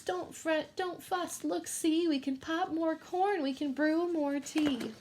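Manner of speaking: high comforting mouse voice; squeaky mouse. Acted. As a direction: soothe the bear as the tiny mouse. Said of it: "Mouse squeaks".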